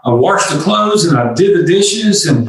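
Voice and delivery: sulking husband to wife, mildly hammed-up complaint; hurt complaint. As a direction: play the sulky husband lightly. Husband complaining in retold scene.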